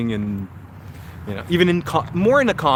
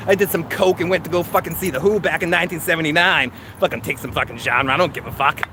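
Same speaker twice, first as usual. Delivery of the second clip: thick, hammed-up Boston tough-guy accent, often higher in pitch; 4 semitones higher than usual. [name] doing a requested Ray Donovan for a live chat, Boston accent. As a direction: rant like a worked-up Boston local. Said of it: "give us your best Boston accent".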